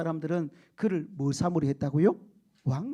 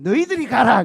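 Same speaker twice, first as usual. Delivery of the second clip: louder order to leave; loud command; 4 semitones higher, 14 dB louder than usual. Retold or imitated. Preacher imagining God sending the careful ones away, loud. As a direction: bark it out as God. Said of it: "그랬을 거예요".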